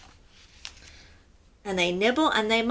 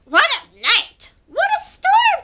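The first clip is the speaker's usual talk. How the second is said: high, loud chirpy bird voice; twittering wren; 8 dB louder than usual. A narrator's reading. Wren chattering as friends crowd in. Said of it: "twitters wren".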